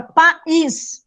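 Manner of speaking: "país" broken in two as pa, ís; syllables separated. Shown as a demonstration. Teacher showing students a hiatus, syllables separated. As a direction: split "país" with a small gap before the stressed ís. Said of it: "São vogais em sílabas diferentes".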